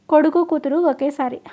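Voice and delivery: usual speaking voice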